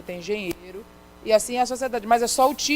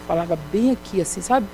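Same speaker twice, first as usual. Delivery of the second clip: ordinary delivery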